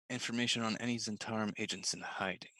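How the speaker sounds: secret whisper. Acted. Spy whispers a secret request to the horse. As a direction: breathe it so only the horse hears.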